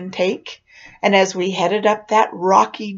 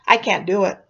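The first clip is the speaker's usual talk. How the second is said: ordinary delivery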